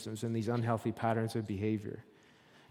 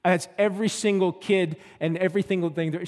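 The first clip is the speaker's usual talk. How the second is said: louder, higher, defiant retelling; angry insistence; 5 semitones higher, 7 dB louder than usual. Retold or imitated. Woman arguing with her counselor, loud and defiant. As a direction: insist it's the whole story, heated. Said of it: "And she's getting angry".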